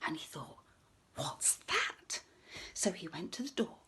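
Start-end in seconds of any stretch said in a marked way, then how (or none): 1.1-2.2 whisper. Acted. Farmer wonders at noise.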